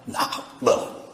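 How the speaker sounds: whispered, almost voiceless aside; hushed reply. Acted. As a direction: whisper it as a small confession. Acting out a reply in heaven.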